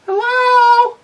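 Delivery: high calling hello; from another room. Real, not acted. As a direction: call out to someone upstairs. Calling out to someone arriving.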